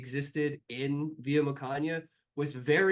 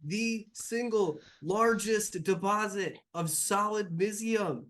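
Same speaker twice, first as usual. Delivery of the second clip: stunned dismay; 7 semitones higher than usual. Acted. NPC stunned by loss.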